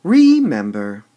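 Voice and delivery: overdone RE at the start of remember; stress on first syllable. Shown as a demonstration. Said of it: "Would we say the word remember?".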